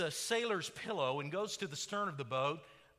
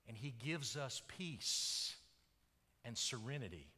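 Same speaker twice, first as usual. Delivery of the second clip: hushed preaching; 8 semitones lower, 7 dB quieter than usual. Acted. Preacher telling how the storm subsided into peace, hushed. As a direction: let it sink gently into stillness.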